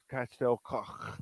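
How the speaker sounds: Castel Coch said slowly, Coch ending in a throaty rasp; Welsh pronunciation. Shown as a demonstration. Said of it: "they say it in their language".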